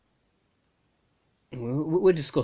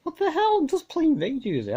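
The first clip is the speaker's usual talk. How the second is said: indignant outburst; 4 dB louder than usual. Retold or imitated. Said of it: "I'm like".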